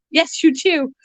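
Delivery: high teasing pet voice; sing-song pet scolding. Real, not acted. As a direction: tease the bird up high. Talking to bird.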